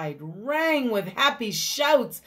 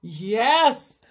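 plain reading voice

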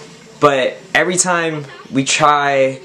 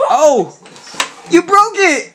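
surprised; 12 semitones higher, slower than usual. Real, not acted. Scooter mishap.

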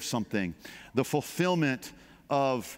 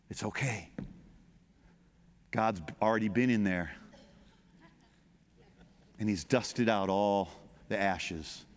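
much softer, low reassuring voice; tender comfort; 5 semitones lower, 3 dB quieter than usual. Real, not acted. Pastor comforting the congregation, soft and tender. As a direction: drop down and soothe them gently.

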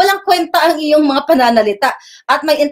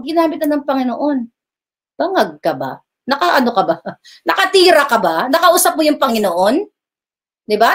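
normal talking voice

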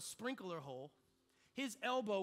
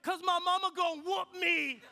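high, loud hammed-up bawling; imitating a weeping, terrified kid; mock crying; 7 semitones higher, 9 dB louder than usual. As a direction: wail it like a kid dreading punishment. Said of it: "why are you crying".

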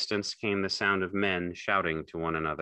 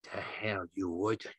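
scornful rabbit; 6 dB quieter, slower than usual. A narrator's reading. Rabbit taunts Lenny.

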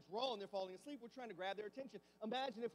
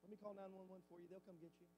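offhand indifference; 14 dB quieter than usual. Retold or imitated. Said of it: "and you're like".

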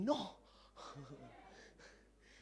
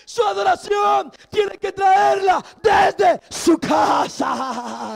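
loud, ringing sermon voice, higher and louder; preaching at full force; 5 semitones higher, 12 dB louder, slower than usual. Acted. Preacher urging the congregation to praise God fully, shouting. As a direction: preach it out to the whole church.

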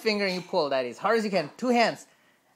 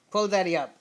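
ordinary talk

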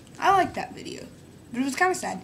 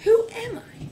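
whisper; 11 semitones higher than usual. Acted. Whispered self-doubt.

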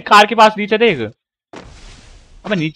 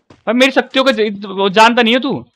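ordinary talk

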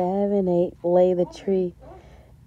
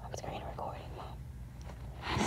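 whisper; 21 dB quieter, slower than usual. Real, not acted. Hushing mom while recording.

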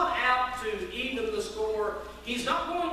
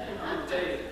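ordinary delivery

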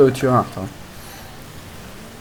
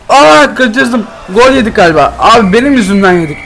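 dismayed outcry; 7 semitones higher, 6 dB louder than usual. Real, not acted. Conceding a goal.

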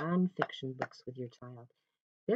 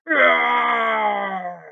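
long, very loud lion roar falling away; big drawn-out playful roar; 10 dB louder than usual. Pretend. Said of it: "hear me roar".

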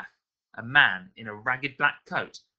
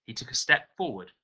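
plain reading voice

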